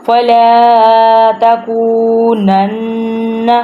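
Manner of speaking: slow recitation, "تَكُونَنَّ" held with a nasal double n; nasal ghunna. Shown as a demonstration. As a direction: hum the doubled n of "تَكُونَنَّ" through the nose. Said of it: "ناک میں سے آواز نکالنی ہے". ("تَكُونَنَّ" کے نون پر ناک سے غنہ)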